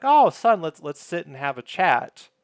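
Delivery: loud, high "oh" easing into a chatty invite; imitating Martin. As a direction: act out a dad's eager invitation. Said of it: "Martin's like".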